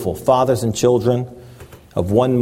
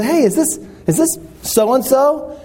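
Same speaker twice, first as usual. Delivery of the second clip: much higher, eager question; eager greeting; 13 semitones higher than usual. Retold or imitated. Pastor greeting an old friend on the phone, delighted. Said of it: "I said".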